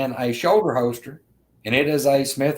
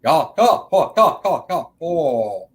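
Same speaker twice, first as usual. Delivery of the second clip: creature chatter; 3 semitones higher, 4 dB louder, much slower than usual. Retold or imitated. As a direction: mimic one creature jabbering fast. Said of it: "the other one would pick up".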